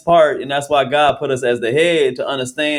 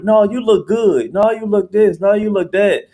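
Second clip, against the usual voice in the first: mock reassurance; 7 semitones higher than usual. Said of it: "you have this urge to be like".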